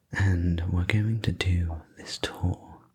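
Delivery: usual speaking voice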